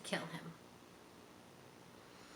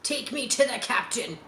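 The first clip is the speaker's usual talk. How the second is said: ordering; 4 semitones higher than usual. A narrator's reading. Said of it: "he ordered".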